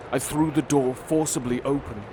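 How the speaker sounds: ordinary delivery, nothing marked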